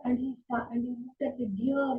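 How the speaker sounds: usual speaking voice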